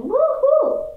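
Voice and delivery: high, drawn-out whoop; cheering. A narrator's reading. Narrator celebrates her sister finally improving her hygiene, high cheer.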